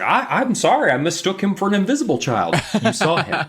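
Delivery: high-pitched mock apology; hammed-up excuse from a trigger-happy neighbor; trigger-happy townsperson. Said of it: "people who are trigger happy. It's like".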